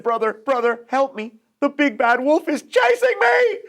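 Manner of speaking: high, rising panicked cry for help; panicked plea. Acted. Pig begging his brother to open up, panicked. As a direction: beg for help, out of breath.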